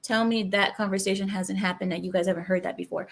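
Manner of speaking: normal talking voice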